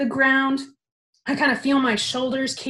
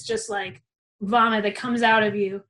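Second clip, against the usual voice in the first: ordinary delivery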